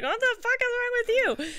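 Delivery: very high, squealed outrage; mock freak-out. Yuna flipping out at his headache, mock high-pitched freak-out. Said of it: "She's like".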